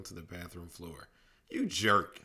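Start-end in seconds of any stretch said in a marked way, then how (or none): 1.5-2.2 loud angry shout; angry cry. Acted. Lily yelling at Manny after his prank. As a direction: yell it at the prankster. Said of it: "Lily cried angrily".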